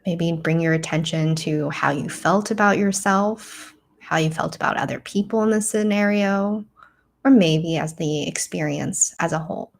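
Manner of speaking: calm, measured meditation voice with long pauses; guided meditation. Real, not acted. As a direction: guide them gently inward, leaving space to breathe.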